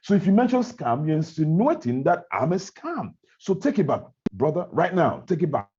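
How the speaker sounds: high-pitched, argumentative insistence; heated, offended case-making; indignant rebuttal. Acted. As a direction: press the accuser to take it back. Acting out argument over scam accusation.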